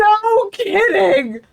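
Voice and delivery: high, stretched mock yell; hammed-up exclamation. Co-host echoing a ski pun, hammed-up yell. Gleeful echo of the ad's pun.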